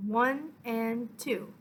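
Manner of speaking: slow, drawn-out, measured count; counting the beat. Shown as a demonstration. Violin teacher counting out a held first note, slow measured count. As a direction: stretch each beat evenly. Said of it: "you're going to hold that first note".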